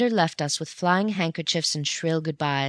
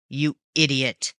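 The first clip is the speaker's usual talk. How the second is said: snarl. A narrator's reading. Raffles snarling at Bunny in his cabin. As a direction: spit out the insult. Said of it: "he snarled".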